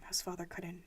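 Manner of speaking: plain reading voice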